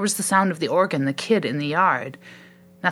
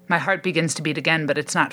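ordinary delivery, nothing marked

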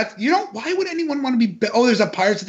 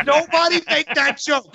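higher-pitched protest over laughter; exclaimed, half-laughing order; insistent warning; 8 semitones higher than usual. Real, not acted. Repeats warning over their laughter.

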